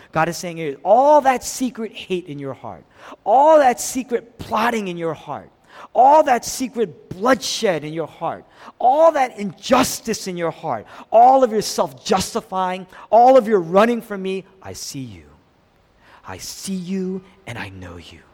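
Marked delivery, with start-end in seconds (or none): 0.8-14.4 loud, raised-pitch accusation; insistent, building list of charges; mounting indictment. Acted. God lists Cain's hidden sins. 14.6-18.2 low, soft, knowing tenderness; quietly tender and sure; gentle, tender. Acted. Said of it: "he says it gently".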